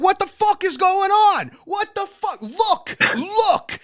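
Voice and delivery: very high, drawn-out incredulous cry; imitated urgent outrage. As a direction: mimic a fired-up street filmer demanding attention. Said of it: "grabbing the viewer's face".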